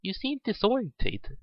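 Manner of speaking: put-on concerned small child, gently; young girl's voice. A narrator's reading. Rabbit girl worried about the lost pony, high childlike voice. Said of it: "young and high-pitched, definitely female".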